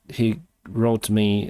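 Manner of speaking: ordinary delivery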